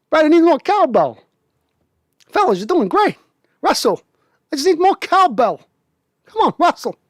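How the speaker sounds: hammed-up Walken begging for cowbell; Walken cowbell impression. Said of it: "Walken's just, it's lacking".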